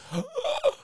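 very high shocked gasp; dramatic gasp. Real, not acted. Hearing the poster went missing, dramatic gasp. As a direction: gasp in mock horror.

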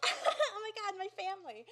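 gushing delight. Retold or imitated. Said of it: "So I was like".

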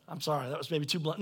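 ordinary delivery

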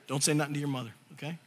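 low, soft conspiratorial aside; hushed confiding. Retold or imitated. Dad covers up to his son, hushed aside. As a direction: drop it low like a shared secret.

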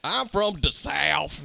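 slow, badly faked Southern drawl; hammed-up Southern accent; mock Southern accent. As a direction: stretch it out in a phony Southern accent. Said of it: "bullshit Southern accent".

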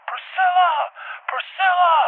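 high, drawn-out calling; imitated yelling. Dad calling the dog across the water, imitated high yell. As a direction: mimic someone calling a dog from afar. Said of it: "Someone's yelling my dog's name".

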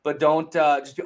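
ordinary delivery

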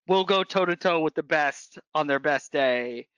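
ordinary delivery

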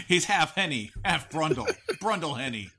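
words spilling out through laughter; laughing while talking. Real, not acted. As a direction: giggle through the line.

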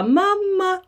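imitating a child calling out. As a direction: call mamma like a toddler from far away. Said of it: "stå i ett annat rum och ropa".

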